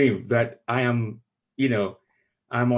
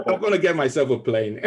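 laughing; 5 semitones higher, 3 dB louder, faster than usual. Real, not acted. Laughing after the announcement.

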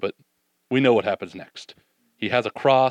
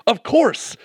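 mock agreement; 11 semitones higher than usual. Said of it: "the disciples were like".

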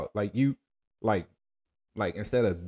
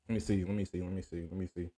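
fading mutter; 3 semitones lower, 8 dB quieter, faster than usual. Real, not acted. Muttering while checking.

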